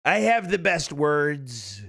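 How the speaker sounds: slow, stretched-out boast in impression; Donald Trump. Streamer quoting the president, slow Trump impression. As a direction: draw out each word as a parody. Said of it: "I think he said that".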